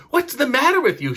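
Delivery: a mum lightly hamming up her alarm; mild alarmed cry. Acted. Mum rushes into Charlie's room, mildly alarmed cry. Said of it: "she cried".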